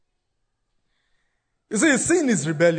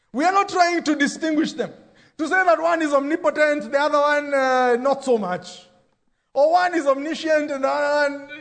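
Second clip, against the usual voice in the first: raised, emphatic preacher voice; preaching; 6 semitones higher than usual. Acted. Pastor preaching to the congregation, raised and emphatic. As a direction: drive the point home to the church.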